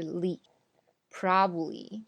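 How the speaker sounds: ly alone, then probably as PROB-lee; slow, probably in two syllables; probably without the middle "ba"; two-syllable breakdown. Shown as a demonstration. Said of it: "就变成了两个音节".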